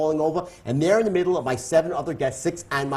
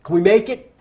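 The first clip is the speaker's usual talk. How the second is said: calling out over wind; 5 semitones higher, 7 dB louder than usual. Retold or imitated. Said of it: "I said to the captain".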